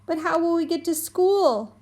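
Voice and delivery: put-on high, pouty little-boy voice; a small boy pouting at his mom. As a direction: sulk it out like a little kid.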